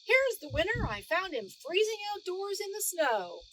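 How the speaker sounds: high, loud proud announcement. A narrator's reading. Guard presenting Norman to the judges, high and proud. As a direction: present the winner with pride. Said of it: "said proudly".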